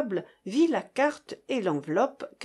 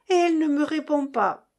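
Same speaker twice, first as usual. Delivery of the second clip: loud, high indignant cry; 7 semitones higher, 5 dB louder than usual. A narrator's reading. Mother complains about silent daughter, loud high cry. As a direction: shout it across the room. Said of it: "cria madame de Staël".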